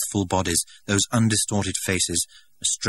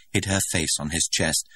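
usual speaking voice

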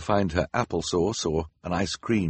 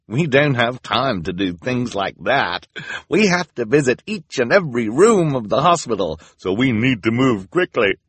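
cheerful volunteer; 4 semitones higher, 4 dB louder than usual. Acted. Bearded volunteer dismissing patients.

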